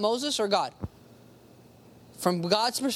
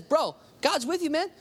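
casual, higher-pitched bro talk; encouraging buddy; 6 semitones higher than usual. Retold or imitated. Nathan casually cheering on David, encouraging. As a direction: lift it like a friend urging someone on. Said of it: "it was an encouraging word".

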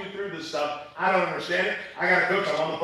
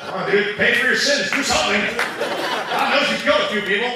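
loud and high, laughing through it; hammed-up plea to the scary guy, half laughing; laughing mock shout; 6 semitones higher, 7 dB louder than usual. Pastor's imagined jab at the mobster during prayer, laughing and loud. Mocking thought aimed at mobster.

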